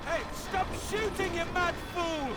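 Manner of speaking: furious bellow across the snow; angry shout. Acted. Survivor yelling at a man shooting at him, high and loud.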